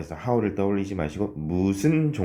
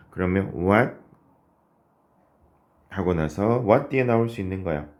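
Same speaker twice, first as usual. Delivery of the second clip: ordinary talk, nothing marked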